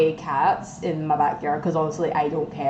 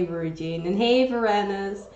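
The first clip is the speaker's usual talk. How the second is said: ordinary delivery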